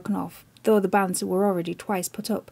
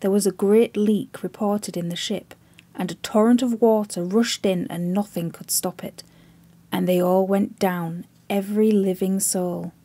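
ordinary delivery, nothing marked

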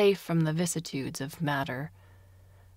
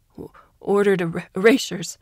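louder, stumbling, breaking; terrified stammer; 2 semitones higher, 8 dB louder than usual. A narrator's reading. Prisoner confessing under the knife, terrified stammer. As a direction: blurt it out in panic.

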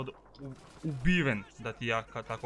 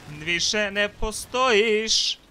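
slow, loud, higher gloat; gloating taunt; 7 semitones higher, 6 dB louder, much slower than usual. Real, not acted. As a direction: drag out the victory taunt. Taunting a killed enemy.

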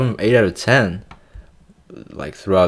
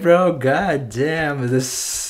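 normal talking voice

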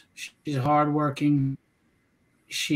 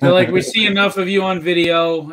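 loud, joking relay; calling out; 2 semitones higher, 7 dB louder than usual. Retold or imitated. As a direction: call it across to someone off mic. Calling a teasing message to wife.

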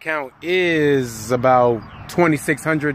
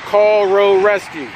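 slow, loud, carefully spaced command; giving the phone a slow, loud voice command; 6 semitones higher, 5 dB louder, slower than usual. Shown as a demonstration. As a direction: speak clearly to the phone assistant. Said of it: "I have voice".

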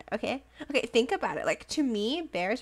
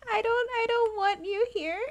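high laughing plea; 12 semitones higher than usual. Real, not acted. Addressing villager, laughing.

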